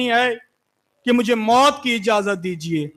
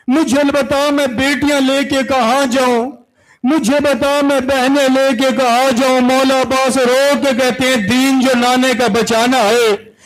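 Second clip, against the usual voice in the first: rising, louder grieving recital; impassioned lament; 4 semitones higher, 4 dB louder than usual. Acted. Preacher driving mourners to weep, rising wail. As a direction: build the cry toward the climax. Narration builds toward climax.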